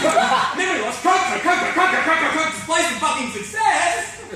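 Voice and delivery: high, loud, repetitive outburst; manic frenzy. Acted. Rock star answers every symptom with coke, manic shouting.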